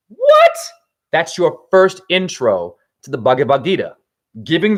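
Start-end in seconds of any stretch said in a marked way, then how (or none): 0.1-0.5 sudden high, loud what; shocked disbelief, shot way up. Real, not acted. Outraged at Gita's opening story.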